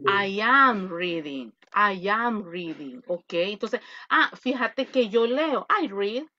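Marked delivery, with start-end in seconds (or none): 0.1-3.0 slow, measured demonstration for students; drawn-out teaching example; slow model sentence. Shown as a demonstration. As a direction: say "I am reading" slowly, word by word. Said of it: "cuando usted está haciendo la acción".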